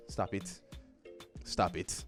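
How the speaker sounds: normal talking voice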